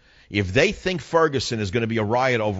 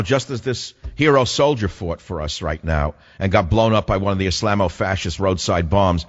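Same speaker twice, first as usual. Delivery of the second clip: usual speaking voice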